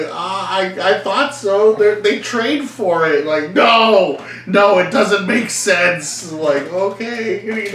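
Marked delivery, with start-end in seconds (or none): 0.0-6.3 put-on high, loud kid protesting; young Louis. Young boy arguing with aunt about wrestling, high and loud. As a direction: plead like an eight-year-old. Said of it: "I'm like".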